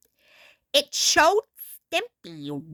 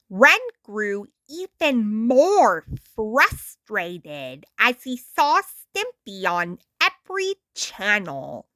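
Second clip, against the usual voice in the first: usual speaking voice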